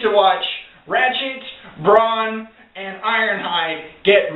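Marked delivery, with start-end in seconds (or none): none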